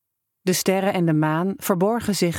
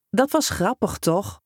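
teasing; 3 semitones higher than usual. A narrator's reading. Prankster teasing upset boy.

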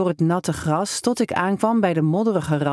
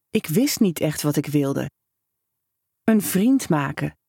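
usual speaking voice

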